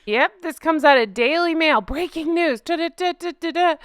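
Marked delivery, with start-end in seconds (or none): 1.8-3.7 high, rapid da-da-da news sting; breaking news noise. Shown as a demonstration. Host announces Daily Mail story, mock breaking news fanfare. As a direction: imitate an urgent news jingle with your voice. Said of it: "That's my breaking noise".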